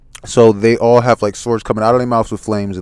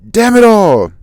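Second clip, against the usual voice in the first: high, loud frustrated yell; acted cry of exasperation; cursing shout; 13 semitones higher, 8 dB louder than usual. Character cursing as he is hit.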